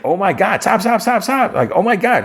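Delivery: screaming to halt a reversing car; panicked yelling. Retold or imitated. Car lurches backwards, [name] panics.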